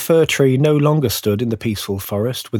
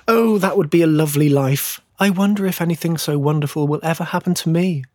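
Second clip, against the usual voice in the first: higher, dreamy little tree voice; longing fir tree; 6 semitones higher, faster than usual. A narrator's reading. Fir tree dreaming of a Christmas life, high and yearning. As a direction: wish for it, pitched up and wistful.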